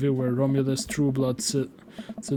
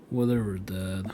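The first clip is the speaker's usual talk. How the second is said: put-on sulky teen; 7 semitones lower, 5 dB quieter than usual. Sulky son answers his father.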